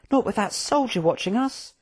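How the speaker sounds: high, small, worried voice; timid Hectus. Acted. Hectus despairing to Quilk in the cell, timid.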